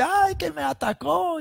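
high, shrill cry of attack; wife crying out to her husband; mock cry of alarm. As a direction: act out the wife's outcry. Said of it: "y dice".

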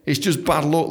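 ordinary delivery

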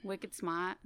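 put-on Boston accent. Podcast fan echoes the hosts' slang, Boston accent. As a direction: drop the R like a Bostonian. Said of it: "you sound like you're from fucking Boston".

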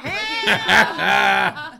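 very loud comic yell; shouting Ham. Pretend. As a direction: burst it out like a crying shout. Speaker echoes shout.

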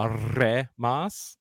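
"wakaremasu" slowly, with a rolled R. Shown as a demonstration. Teaching Japanese pronunciation, "wakaremasu" with rolled R. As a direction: roll the R in "wakaremasu". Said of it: "You have to roll the R".

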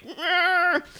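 high, strained animal moan; wounded tiger growl. Acted.

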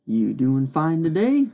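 usual speaking voice

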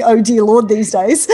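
loud, exasperated "Oh, dear Lord"; exasperated outcry. Shown as a demonstration. Mum swapping a swear word for a loud exasperated cry. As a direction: blurt it out instead of swearing. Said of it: "I'm saying".

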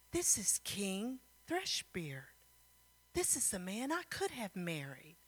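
acted awestruck whisper; stunned realization. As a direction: breathe it out in soft astonishment. Stunned recognition of the king.

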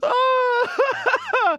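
high, helpless laughing through the words; punchy, real laughter. Real, not acted. Host laughing at knocking his headphones off, punchy laughter. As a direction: crack up and keep talking. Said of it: "I'm punchy today".

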